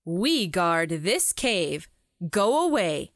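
stern guard. Acted. Guard repeats his warning.

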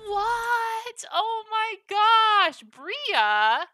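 squealing, high and loud with delight; overjoyed thanks, voice soaring; excited. Real, not acted. Big donation arrives.

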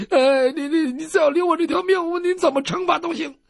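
high, stuttering cringing voice; stammer, desperate to live; stammering plea. Acted. Captive doctor accepting any punishment, high stammering plea.

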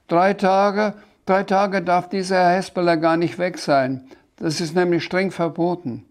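laut und deutlich höher; empörter Protest. Acted. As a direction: energisch protestieren, mit hoher, lauter Stimme. Sams protestiert empört gegen Wartezeit. (empört, laut und hoch)

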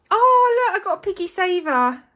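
high, loud, quick squeal of delight; excited squeal. Real, not acted. Showing viewers her new piggy saver, squealing with excitement.

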